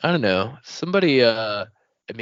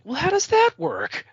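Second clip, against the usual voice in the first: puzzled. Retold or imitated. Mock puzzled question, voicing himself.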